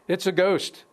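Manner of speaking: terrified disciples crying out at the water; cried out in fear. A narrator's reading. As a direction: blurt it out, startled by a ghost. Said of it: "cried out in fear".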